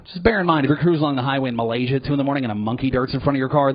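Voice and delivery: normal talking voice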